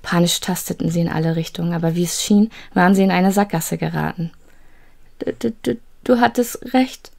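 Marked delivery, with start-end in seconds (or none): 5.2-7.1 put-on a girl stammering in terror; frightened stammer. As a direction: stutter out of sheer fear.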